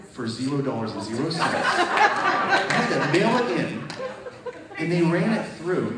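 high, loud, laughing through it; real laughter at a zero-dollar check; laughing disbelief. Real, not acted. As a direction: crack up at the absurdity.

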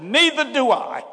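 high, loud and slow divine reply; God agreeing. Retold or imitated. God answering the frustrated preacher, high and loud. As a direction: answer him with a wink.

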